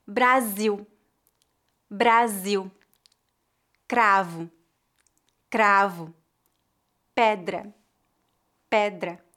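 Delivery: "Ra" with a single tap of the tongue tip; tapped R. Shown as a demonstration. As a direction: flick the tongue tip once on "ra". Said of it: "Repita depois de mim para praticar".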